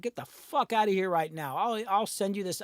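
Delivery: usual speaking voice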